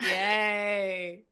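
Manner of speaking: drawn-out mock cheer; loud, sarcastic hooray. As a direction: imitate a sarcastic little celebration. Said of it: "he's so sarcastic".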